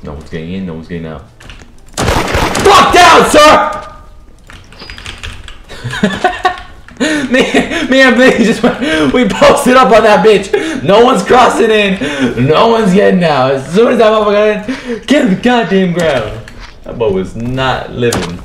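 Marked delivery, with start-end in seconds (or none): 2.0-3.9 very high, loud panicked yell; frantic shout. Real, not acted. Yelling during gunfight. 4.8-7.3 wild breathless laugh; high excited cackle. Real, not acted. Laughing after fight. 7.3-16.5 high, loud excited bragging; hyped bragging. Real, not acted. Hyped after winning fight.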